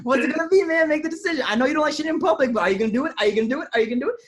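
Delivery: high, loud, insistent badgering; mock pressuring shout. Body pressing its owner to decide, loud mock badgering. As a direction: hammer the questions like a pushy coach. Voicing the body demanding a decision.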